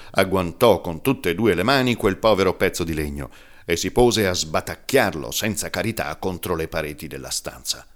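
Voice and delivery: plain reading voice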